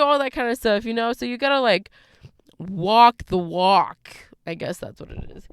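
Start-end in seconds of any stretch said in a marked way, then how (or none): 2.6-4.2 slow emphatic. Real, not acted. Emphatic slow closing line.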